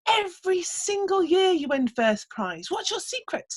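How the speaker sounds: high, eager admiring voice; admiring villagers. Retold or imitated. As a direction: gush at him like a curious neighbour. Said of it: "everybody would come up to him and say".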